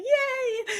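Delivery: a squealed high yay of delight; excited yay. Retold or imitated. Guest reacting to praise of her writing, squealed yay. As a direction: squeal it like a thrilled kid. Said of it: "it's like".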